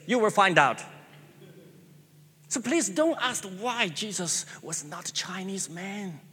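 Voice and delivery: ordinary talk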